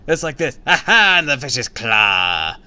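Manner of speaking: loud giddy cackle into mock menace; playful spooky act. A narrator's reading. Neighbor kid acting out a monster claw on Livy, loud. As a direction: scare the sister for fun.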